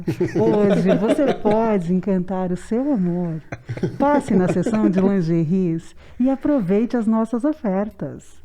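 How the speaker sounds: slow, seductive announcer voice; sensual voice. Shown as a demonstration. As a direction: purr the sale slowly. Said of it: "com uma voz sensual".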